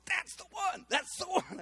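high, eager burst of recognition; excited confirmation. Retold or imitated. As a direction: blurt it out, thrilled he was right. Bob excitedly confirms the lady.

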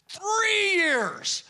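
high, drawn-out cry of disbelief; exasperated exclamation. Retold or imitated. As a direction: stretch it out, high and fed up. Exasperated at the long wait.

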